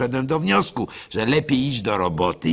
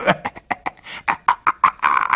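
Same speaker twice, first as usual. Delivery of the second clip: breathy nervous laugh; 10 semitones lower than usual. Acted. Nervous laugh at own fear.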